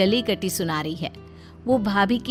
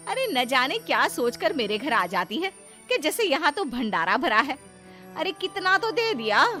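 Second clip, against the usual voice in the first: sweeping, high-pitched indignation; animated, exasperated griping; scornful complaint; 5 semitones higher, 4 dB quieter than usual. Acted. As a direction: run the visitor down with swooping pitch. Wife scorns the begging relative.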